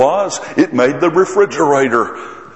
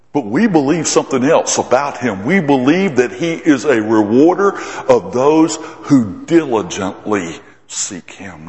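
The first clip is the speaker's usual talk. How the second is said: ordinary talk, nothing marked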